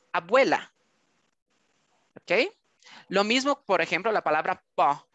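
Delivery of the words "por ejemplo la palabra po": usual speaking voice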